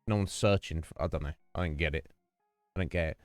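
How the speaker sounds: ordinary delivery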